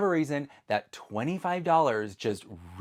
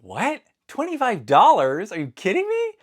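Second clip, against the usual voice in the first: rising, louder disbelief at the price; retold outrage; 10 semitones higher, 8 dB louder than usual. Shopper retelling seeing the shipping fee, incredulous. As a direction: climb higher with each question, incredulous. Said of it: "and I'm like".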